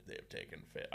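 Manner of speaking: whispered, fading pig line; meme whisper. Retold or imitated. Reciting the pig meme in a whisper. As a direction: hush it like a creepy recitation. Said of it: "I would recite the whole thing".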